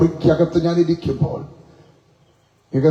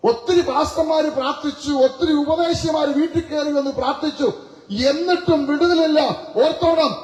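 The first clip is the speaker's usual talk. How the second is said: higher, fervent preacher's cry, quickening; preaching at full force; 9 semitones higher than usual. Acted. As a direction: drive it home to the congregation. (ഉയർന്ന ശബ്ദത്തിൽ തീക്ഷ്ണമായ പ്രസംഗം)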